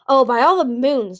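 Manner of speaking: loud, slow, exasperated exclamation; cross. A narrator's reading. Darkstalker snapping at Moon's accusation, crossly. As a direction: grumble it out, drawn out and fed up. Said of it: "Darkstalker said crossly".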